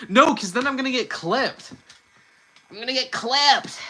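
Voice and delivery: high cartoon hero protesting; Sonic. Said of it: "Say something so out of pocket as Sonic".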